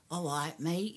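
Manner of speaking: an American's fake Cockney, lightly done; mock American Cockney. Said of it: "that sort of Cockney that".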